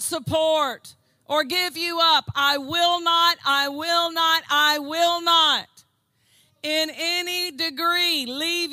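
rising, emphatic pulpit delivery, slowing on each I will not; hammering the promise home to the church; preaching. Acted. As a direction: preach it with growing force. Preacher building on scripture.

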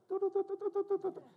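quick high-pitched "do-do-do" signal; rapid high telepathy beeps; imitated beeping. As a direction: fire off high little beeps like a silent signal. Imitates wordless look between spouses.

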